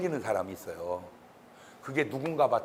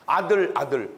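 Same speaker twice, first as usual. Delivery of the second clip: normal talking voice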